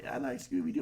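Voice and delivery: high, childish mock voice of a little boy; kid voice. Friend mimics him as a kid, high and childish. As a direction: squeak it out like a small kid. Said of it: "You're like".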